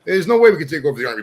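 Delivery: ordinary talk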